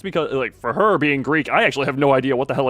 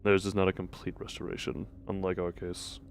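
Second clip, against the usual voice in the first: a hint of a lower, subdued cold figure; lightly voiced grim character; 7 semitones lower, 10 dB quieter, much faster than usual. Voicing a game character's dialogue, quietly grim. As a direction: read it like a detached observer.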